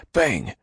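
high loud bang. A narrator's reading. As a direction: slam it out like a hammer. Banging sound imitated.

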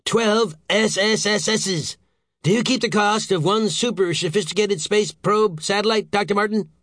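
high-pitched indignant demand; scornful anger. A narrator's reading. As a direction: spit out the initials, then challenge him. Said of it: "snorted Saint George".

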